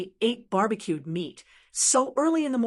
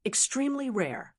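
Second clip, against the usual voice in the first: usual speaking voice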